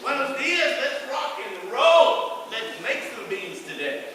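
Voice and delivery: rally the crew at dawn; morning hype. Retold or imitated. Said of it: "this is what I do".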